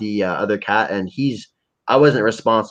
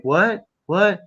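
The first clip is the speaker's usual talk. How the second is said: friend asking; 9 semitones higher than usual. Retold or imitated. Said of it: "he's like".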